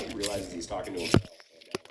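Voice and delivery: ordinary delivery, nothing marked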